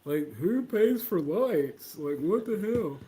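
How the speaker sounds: put-on slow, incredulous dopey boy; mock a clueless clerk's disbelief; drawn-out mock bafflement; baffled store clerk. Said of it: "He was like".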